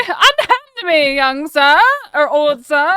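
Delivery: high, slow, laughing theatrical protest; laughing mock outrage. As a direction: shriek it through laughter like a melodrama heroine. Mock old-fashioned protest, laughing.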